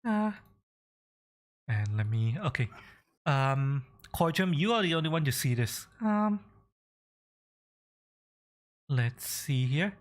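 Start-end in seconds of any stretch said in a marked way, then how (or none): none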